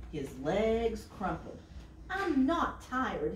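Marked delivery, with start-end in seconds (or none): 2.1-3.3 cried out. A narrator's reading. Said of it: "he cried".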